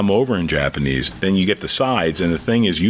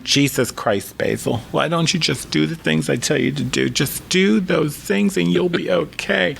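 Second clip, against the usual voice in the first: higher-pitched exasperated mom voice; mom's exasperated lecture, pitched up; American mother; 8 semitones higher than usual. Retold or imitated. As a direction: nag like an American-born mother. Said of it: "My mother, American born".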